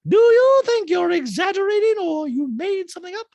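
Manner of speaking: high, loud mocking impression of Tariq. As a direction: lampoon him in a high, loud put-on voice. Mocking Tariq's question in a scene.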